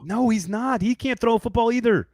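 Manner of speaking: high, loud, indignant no; heated protest. Real, not acted. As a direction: protest hard, voice jumping up. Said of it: "I knew that would get a reaction from you".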